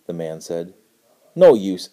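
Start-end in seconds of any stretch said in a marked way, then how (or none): 1.3-1.9 louder, curt snap; snapping boy. A narrator's reading. Boy snaps at the man's vague answer, curt and loud. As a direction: cut him off impatiently. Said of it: "snapped the boy".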